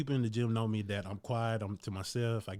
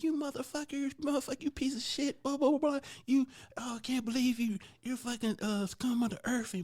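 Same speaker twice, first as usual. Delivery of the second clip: much higher, shrieking mock rant; imitating cursing girlfriend; 13 semitones higher than usual. Girlfriend cursing him out while picking up gear, high mock shriek. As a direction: play the girlfriend cussing him out in a high squeal. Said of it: "She said".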